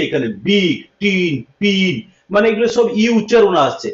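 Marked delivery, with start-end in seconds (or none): 0.5-1.9 বিগ, টিন, পিন with a clear "i" vowel; short i demonstration. Shown as a demonstration. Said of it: "যদি ই উচ্চারণ করে".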